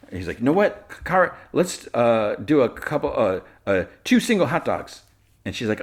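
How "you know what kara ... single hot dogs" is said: high, halting mock-awkward stammer; flustered backpedalling. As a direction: imitate someone fumbling for an excuse. Voices Jimmy awkwardly backpedalling.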